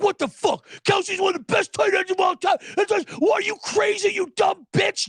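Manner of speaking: high, strained imitation of an outraged man; mock screaming. Trolled boyfriends yelling at their girlfriends, mock screaming. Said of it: "and screaming, like".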